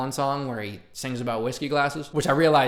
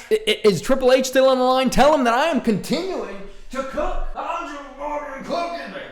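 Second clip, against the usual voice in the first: much higher, squealing mock-triumphant voice; over-the-top hype; 12 semitones higher, 5 dB louder than usual. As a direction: ham it up like you just won the lottery. Mock call to Triple H, bragging.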